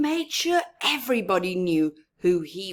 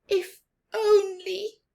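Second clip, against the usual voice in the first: wistful sigh; 11 semitones higher, 4 dB louder than usual. Acted. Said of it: "thought Mouse".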